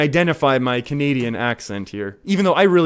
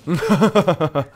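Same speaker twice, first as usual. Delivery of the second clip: real chuckling burst, higher-pitched; laughing; 4 semitones higher, 3 dB louder than usual. Real, not acted. Streamer reacting to the cards played, laughing. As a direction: break into a rapid laugh. Excited streamer after card plays.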